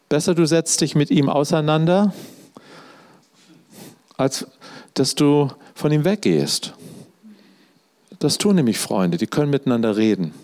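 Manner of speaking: ordinary delivery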